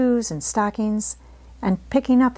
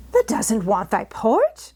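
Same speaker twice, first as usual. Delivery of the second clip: slow, disbelieving Yorkshire exclamation; can't believe she won't eat; incredulous; 3 semitones higher, slower than usual. A narrator's reading. Maid can't believe Mary refuses breakfast, incredulous. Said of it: "Martha exclaimed incredulously".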